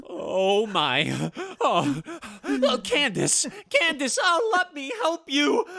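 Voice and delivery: high, loud anguished cry; horrified sobbing. Acted. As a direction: realize she is the one dying. Husband sees wife dying.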